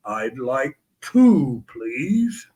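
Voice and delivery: drawn out like a giant asking politely; Andre's slow voice. Retold or imitated.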